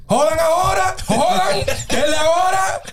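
high shouted cheering with a laugh; shouting drunk at the movie screen. Retold or imitated. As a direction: egg the action hero on like a drunk fan. Drunk cheering Rambo in joke.

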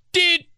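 high, loud electronic beep word; car beep. Retold or imitated. Car lock beep mimicked, high and sharp. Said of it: "the beep sound sounds like it's saying".

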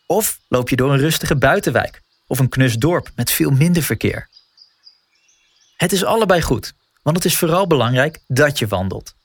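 ordinary delivery

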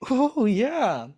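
high, drawn-out retold eureka; delighted, swooping reaction; sudden realization. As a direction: act out the penny dropping. Acts out own moment of realization.

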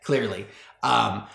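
ordinary talk, nothing marked

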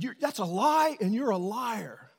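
high, loud accusing shout that eases off; father correcting himself mid-yell, still shouting; angry outburst. Retold or imitated. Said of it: "I kinda blew up".

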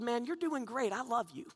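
soft, slightly lifted consoling voice; tender reassurance. Acted. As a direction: comfort him gently, voice dropped soft. Comforting a depressed man.

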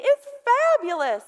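hammed-up "fabulous", swooping high; mock flaky. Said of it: "being flaky and weird and say".

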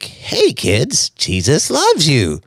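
mock puppet voice. Mocking the camp puppets in a slow, high puppet voice. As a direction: ham up a bad church puppet show. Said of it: "the kids' ministry is puppets, like".

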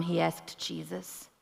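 ordinary delivery

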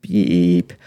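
imitated answering-machine beep; voicemail beep. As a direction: make a long, level voicemail tone. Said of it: "this is my voicemail".

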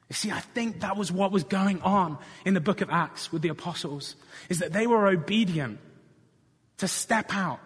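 preacher building force phrase by phrase; impassioned preaching. Real, not acted. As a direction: press the congregation with building urgency.

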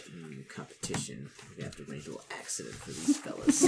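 low, soft schemer's voice; hushed, conspiratorial aside; scheming Gnak. Acted. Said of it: "Gnak immediately is like".

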